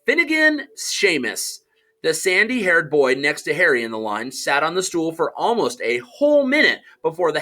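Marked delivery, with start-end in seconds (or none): 0.0-1.6 calling out a name. Acted.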